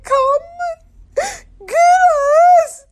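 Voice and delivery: imitated high, broken-up squeak; shrill laughing squeal. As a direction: gasp it out high, word by word. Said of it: "it turns into a shrill".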